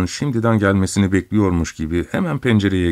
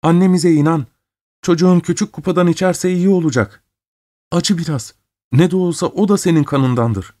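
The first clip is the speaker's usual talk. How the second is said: high, urgent entreaty; pleading; 10 semitones higher than usual. A narrator's reading. Sister begs her brother for her sick child, pleading. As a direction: plead with him in a raised pitch.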